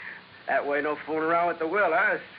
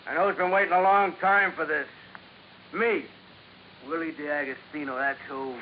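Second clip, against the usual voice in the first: ordinary talk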